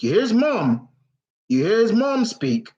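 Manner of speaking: ordinary talk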